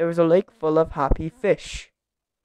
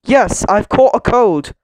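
loud, slow, higher-pitched grumble; grumbling; 5 semitones higher, 8 dB louder than usual. A narrator's reading. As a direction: complain crossly, dragging out the words. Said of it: "grumbled Mister Grumble".

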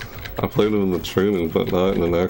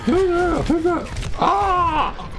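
much higher, shouted alarm; startled high yell; much slower than usual. Real, not acted. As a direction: cry out at a sudden enemy. Surprised by enemy.

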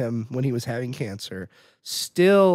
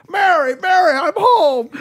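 high, loud homecoming call; mock overjoyed; 13 semitones higher, 7 dB louder than usual. Hero bursting in on his family, hammed-up joyful shout. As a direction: call out like a hammy old-movie husband. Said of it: "he's so happy".

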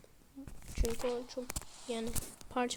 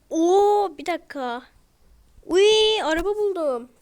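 loud, high yelp of delight at finding a car; excited shout; 6 semitones higher, 12 dB louder than usual. Real, not acted. Gamer finds a car, excited shout. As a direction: burst out thrilled, much louder and higher.

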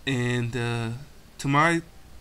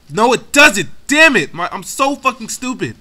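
high, loud burst of self-directed fury; angry shout; 9 semitones higher, 13 dB louder than usual. Real, not acted. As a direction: yell in real anger at your own blunder. Furious at own mistake in battle.

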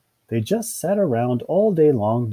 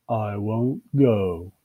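slow, stubborn character voice; stubborn, bored drawl; firm refusal; much slower than usual. Acted. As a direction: refuse very slowly, planting each word. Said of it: "said Bill firmly".

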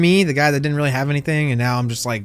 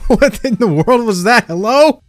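laughing disbelief; 9 semitones higher, 6 dB louder, slower than usual. Real, not acted. Girlfriend's soundboard noise.

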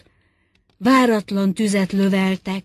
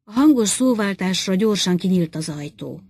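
ordinary delivery, nothing marked

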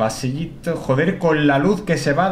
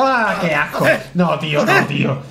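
disgusted cry; 5 semitones higher than usual. Real, not acted. Cockroaches in the game.

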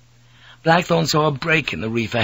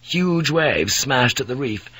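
plain reading voice

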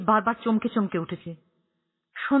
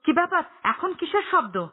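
startled; 7 semitones higher than usual. A narrator's reading. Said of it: "চমকে উঠে বলল".

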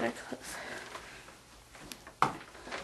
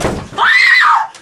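loud high shriek breaking into nervous laughter; scream, then laugh in panic; terrified scream; 14 dB louder than usual. Real, not acted. Trapped in the room, she shrieks and laughs.

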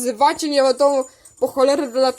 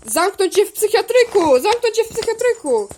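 play-acted threat; 6 semitones higher than usual. Acted quarrel, threatening another figure.

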